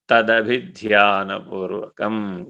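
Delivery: each syllable drawn out very slowly; slow, reverent spelling-out of a sacred term; slow enunciation. Shown as a demonstration. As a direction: lay the word out slowly, weighing every syllable. Key scriptural word slowly enunciated.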